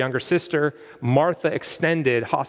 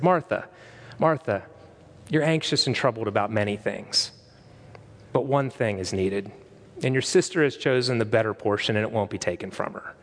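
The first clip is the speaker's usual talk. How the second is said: tender rebuke that calms her down; soft and kind; 5 semitones lower than usual. Retold or imitated. Jesus gently correcting an anxious Martha, softly and kindly. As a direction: lower and softer, gently reassuring. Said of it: "I think he was very soft with her".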